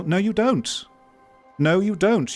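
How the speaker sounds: high-pitched, insistent rebuttal; emphatic contradiction. Real, not acted. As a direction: contradict them firmly, pitch jumping up. Rebutting aspiring developers' assumption.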